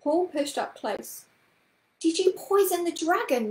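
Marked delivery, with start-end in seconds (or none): 2.0-3.5 put-on high, louder little-boy voice; curious boy. A narrator's reading. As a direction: pipe up with an eager question. Boy's eager question in story.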